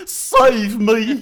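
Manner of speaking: hammy French cry for help; loud, high French plea; French accent. Pretend. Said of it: "say it in a French accent".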